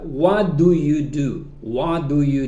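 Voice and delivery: "What do you do?" slowly, every word separate; slow full form. Shown as a demonstration. Teacher reading the example before showing the reduced form. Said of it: "no lo vas a escuchar así".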